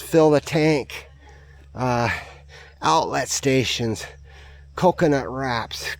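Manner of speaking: ordinary talk, nothing marked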